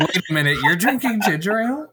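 high, laughing disbelief; surprised. Real, not acted. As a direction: ask in surprise. Surprised question.

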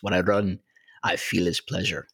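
put-on a clumsy, half-hearted stab at a British movie accent, Chariots of Fire style; terrible movie accent. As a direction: quote the film line in a bad put-on accent. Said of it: "that was a terrible accent".

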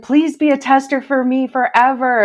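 high, loud retold plea; imitated begging. Designer begging her to be a tester, imitated pleading. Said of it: "she begged me".

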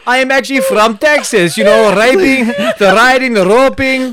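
mock Russian agent posing as Texan; Russian accent. Supposed secret agent claiming Texas, hammed-up Russian accent. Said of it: "they just can't hide their accents".

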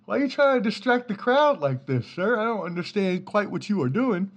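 high, prim, overly formal voice to the mascot; hoity-toity fan scolding; mock polite complaint. Fan scolding the mascot, in a high prim mock-polite voice. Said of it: "I'm like".

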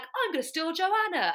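high-pitched mock eager outburst; excited announcement. Sailor suddenly shows up before the judge, excited mock announcement.

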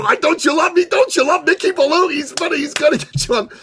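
high, playful mock-pleading; teasing plea. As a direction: coax them like a joking needy friend. Host teasingly pleads with group.

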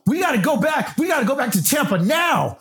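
put-on high shouted call to the kids; yelling child's full name. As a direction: yell across the hotel room. Calling family to leave.

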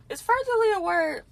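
"furtherly" in a sudden high squeak, loud; incredulous squeak. Real, not acted. The speaker doubts her own made-up word, squeaking in disbelief. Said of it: "I just made that up".

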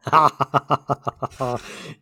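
long burst of laughing; hearty laughter. Real, not acted. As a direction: laugh at a well-told joke. Listener laughs at the punchline.

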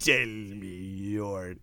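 very slow, low, menacing; evil genie voice. Pretend. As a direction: whisper it like a wicked genie. Joins in the genie voice.

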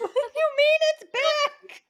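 very high, loud squeal of disbelief; hammed-up, incredulous shriek; mock freak-out. Listener joins acted freak-out.

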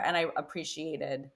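normal talking voice

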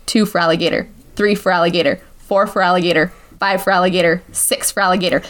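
low, evenly spaced count; deadpan stopwatch count with pauses; measured counting. Shown as a demonstration. Counting out six seconds deadpan.

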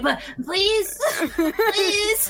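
high, drawn-out begging; pleading. Acted. Character begs another in the game.

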